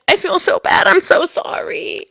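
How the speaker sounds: breathy high apology. Retold or imitated. Quoting apologetic dancer.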